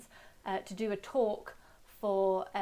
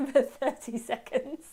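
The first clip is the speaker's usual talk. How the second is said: laughing; 5 semitones higher, 4 dB louder than usual. Real, not acted. Laughs at talking to herself.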